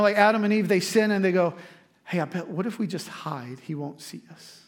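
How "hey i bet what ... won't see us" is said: hushed, trailing softer at the end; quietly scheming, as if not to be overheard; conspiratorial. Acted. Adam and Eve plotting to hide from God, conspiratorial.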